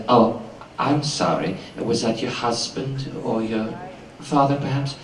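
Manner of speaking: slow, softened, falsely sorry; mock-sympathetic villain. Villain mocking the weeping woman, slow mock sympathy. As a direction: purr a cruel fake apology. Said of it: "turns to the weeping woman and says".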